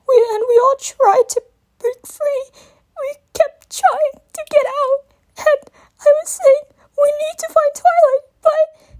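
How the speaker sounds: desperate, cracking voice; crying hard. A narrator's reading. Young dragon sobs out the nightmare to Twilight. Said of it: "speak through his cracking voice".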